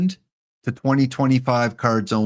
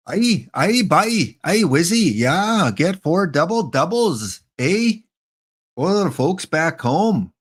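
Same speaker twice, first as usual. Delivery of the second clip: higher-pitched, hammed-up Canadian accent; fake Canadian accent; 5 semitones higher, slower than usual. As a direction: ham up a Canadian with eh. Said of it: "I perfected my fake accent".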